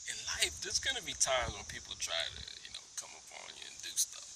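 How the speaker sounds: soft, breathless whispered counsel; whispered advice. Real, not acted. Giving life advice to viewers in a whisper. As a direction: lean in and whisper it. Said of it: "hushed life advice".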